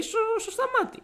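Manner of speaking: very high, halting mimic; imitated headmaster. Headmaster directs student to Stamatis, high impression. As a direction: stumble on it in a high put-on voice. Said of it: "μου λέει".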